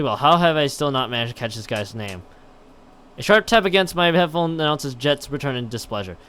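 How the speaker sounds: usual speaking voice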